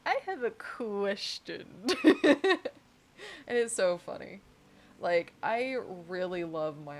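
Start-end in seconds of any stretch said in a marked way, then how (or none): 0.1-2.6 slow, laughing through it; barely holding in a laugh; slow giggling question; giggly. Retold or imitated. Retold question to coworker.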